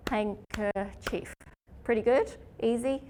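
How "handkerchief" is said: Handkerchief broken into slow separate beats; syllables spelt out slowly. Shown as a demonstration. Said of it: "we all know that's probably gonna be what?".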